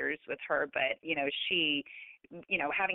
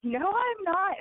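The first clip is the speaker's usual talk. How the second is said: much higher, louder cry of no; mock protest; 13 semitones higher, 4 dB louder, slower than usual. Daughter refusing her mother in the dream, high and loud.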